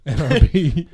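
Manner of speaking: laughing. Real, not acted. Repeating acronym while laughing.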